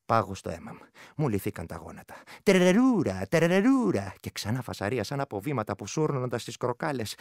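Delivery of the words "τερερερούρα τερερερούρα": louder ghostly trill; eerie creature call. Acted. The vrykolakas calls again to the frightened shepherd, ghostly trill. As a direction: taunt from the darkness.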